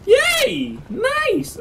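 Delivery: high, squealed little celebration; giddy high-pitched delight; over-excited cheer. Real, not acted. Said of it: "I got a little too excited there".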